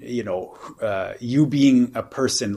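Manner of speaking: usual speaking voice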